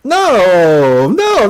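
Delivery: high, laughing "oh no no no"; real amused shock; laughing disbelief. Real, not acted.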